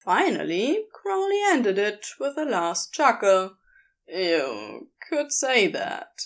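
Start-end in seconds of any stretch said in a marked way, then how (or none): 4.1-6.1 lower, slow, knowing reply; Crowley's voice. A narrator's reading. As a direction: let it roll out slow and sly. Crowley voiced after laughing.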